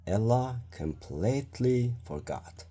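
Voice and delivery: ordinary delivery, nothing marked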